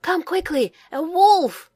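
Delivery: high, loud urgent call; shouting. A narrator's reading. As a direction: shout the alarm. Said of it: "he shouted".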